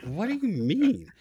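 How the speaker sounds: high, slow, laughing disbelief; baffled laughing question at a politician's claim; incredulous. Retold or imitated. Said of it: "I'm like".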